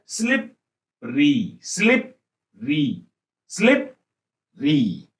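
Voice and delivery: Slippery drawn out slowly with a falling end; slow repetition of slippery; slow drill. Shown as a demonstration. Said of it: "slow drill".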